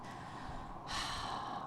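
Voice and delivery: long, soft breathy sigh; regretful sigh. Real, not acted. Cyclist sighs over not stopping for a lady, regretful sigh. Haunted by her own mistake.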